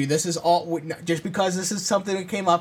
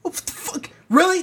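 mock disbelief; 9 semitones higher than usual. Dog quits fetch.

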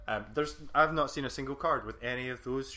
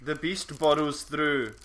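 loud, very slow, raised dramatic read; mock movie trailer voice; 4 semitones higher, 6 dB louder, much slower than usual. Co-host reads out a card title, hammed-up movie voice. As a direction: draw it out like a monster-film trailer.

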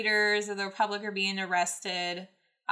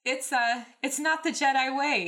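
higher, raised, hesitant objection; tentative protest; 6 semitones higher, 4 dB louder than usual. A narrator's reading. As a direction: try to object despite fear. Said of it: "he tried".

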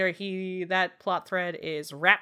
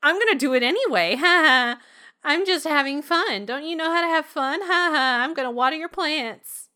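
put-on fast, taunting singsong of a bratty girl; mock bratty child; 6 semitones higher, 4 dB louder than usual. Girl taunting the old man while ruining his garden, bratty and fast. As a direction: tease him like a spoiled kid. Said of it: "she's like".

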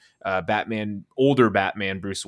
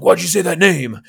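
mock Batman line; 7 semitones higher, 8 dB louder than usual. Mocking Batman's line in podcast.

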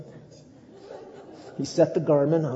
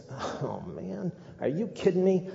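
higher, exasperated complaint; mock disbelief; 7 semitones higher than usual. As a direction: play up the disbelief.

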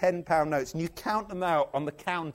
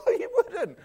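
very high, louder squawk; mock incredulous; 6 dB louder than usual. Preacher contradicting pious readers, mock incredulous. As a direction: blurt out comic disbelief.

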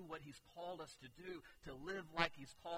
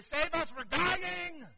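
much higher, louder frantic scream-plea; desperate cry; 13 semitones higher, 15 dB louder, slower than usual. Retold or imitated. Disciples begging sleeping Jesus, desperate panicked cry. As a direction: shriek for help, terrified. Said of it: "We would say".

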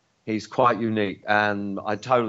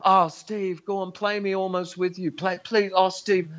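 higher wheedling plea; mock pleading; 9 semitones higher than usual. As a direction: tease him like a fake fan begging for the song. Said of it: "Grant would say to me".

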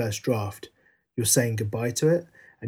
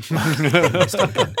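laughing; 5 semitones higher, 4 dB louder than usual. Real, not acted. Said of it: "laughing at own burp".

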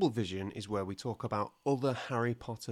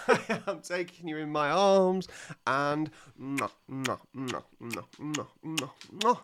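between kisses; 2 semitones higher than usual. Pretend. Kissing sounds.

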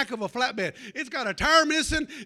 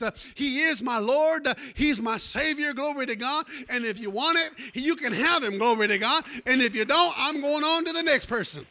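louder and louder, calling out to the church; preaching at full force; 2 semitones higher, 5 dB louder than usual. Acted. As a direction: preach it with fire, building up.

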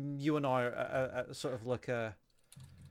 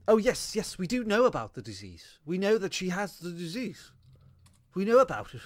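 smug raider boss; 5 semitones higher than usual. Retold or imitated. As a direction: sneer it like a gloating villain. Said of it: "the raiders go".

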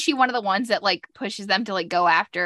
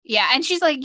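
ordinary delivery

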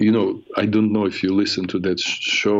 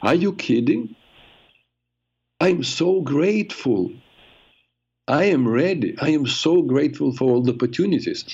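higher, overwhelmed, half-crying thanks; tearful gratitude; 6 semitones higher than usual. Retold or imitated. Telling Gina what she said to the blackness, mildly tearful. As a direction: relive the moment of saying yes with mild tears. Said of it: "I felt I was crying and saying".

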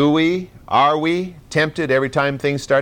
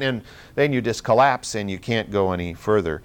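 ordinary delivery

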